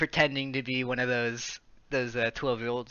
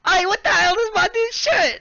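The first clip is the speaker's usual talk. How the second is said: loud, very high mock-outraged yell; squawking, over-the-top protest; indignant outburst; 10 dB louder than usual. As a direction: shout it as a hammed-up whiny complaint. Voicing Scout, tea spilled on him.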